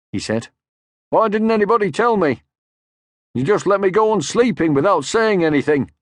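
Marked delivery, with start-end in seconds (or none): 1.1-5.9 higher, louder aggrieved complaint; offended father; indignant Papa. Acted. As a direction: sound hurt and put out. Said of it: "He was feeling very angry".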